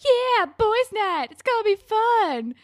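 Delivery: very high, loud, slow hammed-up cheer; mock cheer. [name] and [name] hyping boys' night, high, loud and drawn out. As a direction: whoop it up like dumb jocks.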